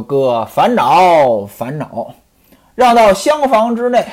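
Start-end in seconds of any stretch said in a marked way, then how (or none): 0.0-2.1 mournful, slow condolence. Acted. Mourners consoling bereaved Ximen Qing.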